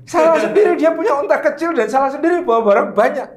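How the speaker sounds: high, indignant jealous wife voice; Aisyah's inner grumble. Retold or imitated. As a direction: grumble like a jealous wife who won't give way. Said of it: "Mungkin dalam hati merasa".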